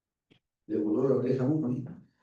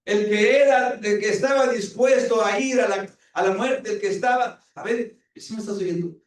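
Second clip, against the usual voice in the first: raised, high preaching voice pressing the point; urgent pulpit appeal, higher and louder; fervent preaching; 8 semitones higher, 6 dB louder than usual. Acted. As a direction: preach it at them, driving hard. Preacher rebukes the congregation.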